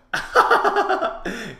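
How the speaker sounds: gleeful high laugh burst; high loud cackle. Real, not acted. Player landing a shot, loud cackle. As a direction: cackle loudly at your hit. Laughs after hitting opponent.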